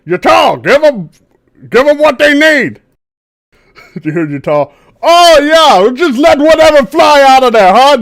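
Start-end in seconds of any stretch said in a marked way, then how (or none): 0.0-2.8 loud, high command; shouting. Acted. Captain ordering the quartermaster to hand over potions, shouting. 5.0-8.0 high, loud mocking retort; sarcastic yell. Grumpy quartermaster yelling back at the captain, sarcastic.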